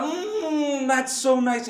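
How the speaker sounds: put-on children's delight. A narrator's reading. Children hearing about the food, pleased child voice. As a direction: hum it high, then approve. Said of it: "And they were like".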